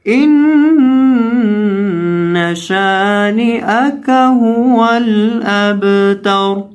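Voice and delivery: melodic tilawat, high and drawn out; slow sung Quran recitation; Quranic recitation. Acted. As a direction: chant each verse slowly in a rising tune. Full surah in recitation style.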